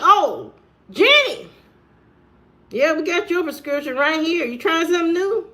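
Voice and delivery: mock cheerful counter voice, pitched well up; chirpy pharmacist. Pharmacist greets a regular customer.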